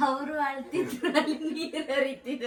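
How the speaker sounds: talking through real giggles; sudden laughter. Real, not acted. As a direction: laugh helplessly through the words. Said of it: "ಇವಾಗ sudden ಆಗಿ ನಗ್ತಿದ್ರಿ". (ನಗು ತಡೆಯಲಾಗದೆ ಮಾತಾಡುತ್ತಾ)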